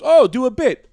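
high, loud eager passenger; cab driver. Retold or imitated. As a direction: plead like an eager fan. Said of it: "This guy goes".

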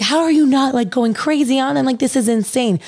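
high, alarmed mock-panic mom voice; imitating a panicked mom. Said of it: "My mom's freaking out".

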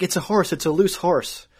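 high-pitched mock of Allie insisting. Girlfriend insisting the moose is a horse, high mock voice. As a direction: pitch it up, sure of herself. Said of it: "And Allie's like".

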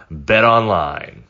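ordinary talk

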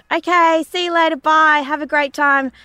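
chirpy bye with a smile; sugary send-off to the neighbour; fake-sweet goodbye. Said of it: "I said".

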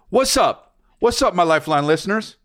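high-pitched showy "what's up"; hyped-up catchphrase greeting. Pretend. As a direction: greet the audience in a playfully hammed-up high voice.

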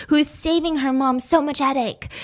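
ordinary talk, nothing marked

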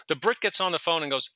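ordinary talk, nothing marked

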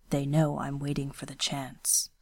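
lightly voiced stern young ronin, hushed; a hint of a guarded swordsman speaking low; quiet, restrained ronin voice; Kenishi, low and quiet.

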